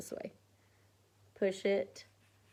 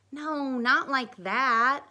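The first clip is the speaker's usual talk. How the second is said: put-on girl voice; 7 semitones higher, 7 dB louder, much slower than usual. A narrator's reading. Amy voice, slow.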